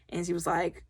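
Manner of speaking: usual speaking voice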